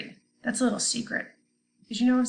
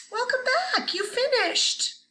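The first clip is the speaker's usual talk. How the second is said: cheery welcome back; 12 semitones higher than usual. Real, not acted. Cheerful greeting to returning class.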